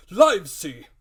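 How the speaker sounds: loud, high call of a name; the squire hailing his friend. Acted. Squire calls the doctor.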